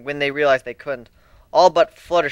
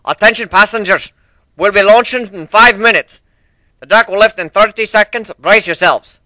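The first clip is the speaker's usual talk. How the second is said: loud, higher announcer voice; ship intercom announcement; 8 semitones higher, 12 dB louder than usual. Acted. Steelhorn announcing launch to passengers, loud intercom call. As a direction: call it out over the speakers. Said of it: "voice came over the speaker system".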